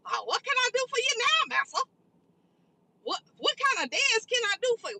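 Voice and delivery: high, sing-song mock of a groveling servant; sneering high-pitched impression of a fawning servant; mocking servile voice. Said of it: "elderly females that would probably say".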